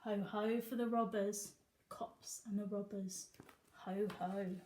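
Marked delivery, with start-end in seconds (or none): none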